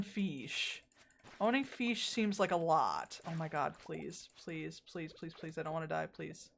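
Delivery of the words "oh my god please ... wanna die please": pleading. Real, not acted. Begging game not to die.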